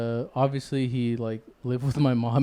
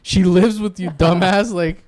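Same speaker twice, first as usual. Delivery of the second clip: loud, higher laughing mock outrage; 7 semitones higher, 7 dB louder than usual. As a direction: laugh through the grandpa rant. Laughing retelling grandpa again.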